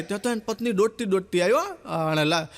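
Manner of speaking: ordinary talk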